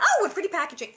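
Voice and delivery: loud, high mock admiration; retold delight. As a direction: imitate cooing over a new parcel, loudly. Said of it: "I went".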